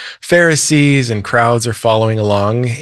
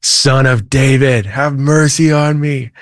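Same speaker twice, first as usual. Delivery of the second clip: drawn-out, higher-pitched cry, not truly loud; mock louder shout; 3 semitones higher than usual. Blind man calling to passing Jesus, restrained retold shout. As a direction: imitate calling out insistently, stretching the words. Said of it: "He shouts even louder".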